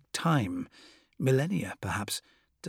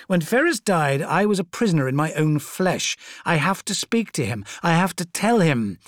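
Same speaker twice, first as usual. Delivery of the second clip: Fulgrim voice; 5 semitones higher, 5 dB louder than usual. Acted. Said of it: "He trailed off".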